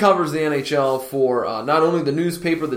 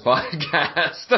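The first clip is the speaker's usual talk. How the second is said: normal talking voice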